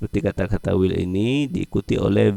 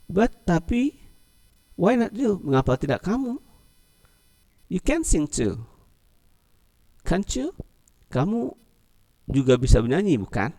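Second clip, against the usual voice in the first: high, coaxing friend; acted dialog line; 10 semitones higher than usual. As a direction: urge them gently to sing. Reads a line, then translates it, in character.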